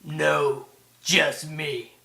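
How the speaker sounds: loud slasher-villain impression; Jackie Earle Haley's Freddy. Shown as a demonstration. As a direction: throw it out loud and menacing. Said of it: "Or he would be like".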